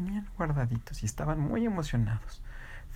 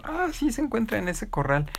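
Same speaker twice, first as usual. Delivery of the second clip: a lightly voiced hen, pitched higher; 12 semitones higher than usual. Farm hen pointing the way, in a higher clucky voice. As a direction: chirp the answer like a helpful hen.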